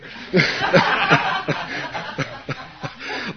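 high, airy laugh at the memory; real laughter. Real, not acted. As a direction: let the laugh burst out. Laughing at his own story.

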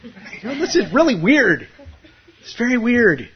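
a teacher gently teasing the student; amused teacher. Retold or imitated. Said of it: "they go to the teacher and they go".

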